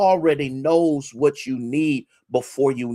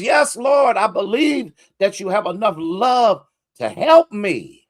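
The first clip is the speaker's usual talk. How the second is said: high, loud cry of faith; high fervent plea; 8 semitones higher, 3 dB louder than usual. Retold or imitated. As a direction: shout your belief to the Lord. Man answers the Lord.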